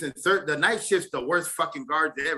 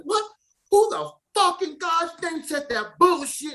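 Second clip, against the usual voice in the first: high, drawn-out mock demand; imitated irate guard; 13 semitones higher, slower than usual. Guard demanding who cursed at him, imitated high holler. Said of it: "this motherfucker yelling".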